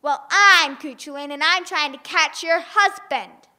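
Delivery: loud proud declaration; loud giant's boast; boastful giant. Acted. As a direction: puff up and announce yourself.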